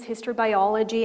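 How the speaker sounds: normal talking voice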